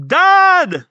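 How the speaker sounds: put-on loud, very high child's shout; calling out. Child calling out to dad who came to rescue, high shout. Colon calls out to father.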